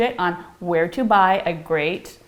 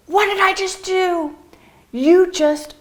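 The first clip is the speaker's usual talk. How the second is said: mock surprise; 8 semitones higher than usual. Excited surprise at discovery.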